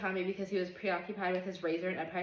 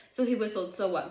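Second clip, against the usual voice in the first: louder, heated angry retort; steaming; 3 semitones higher, 7 dB louder than usual. A narrator's reading. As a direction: fire back, barely holding the anger. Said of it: "Guitar was steaming".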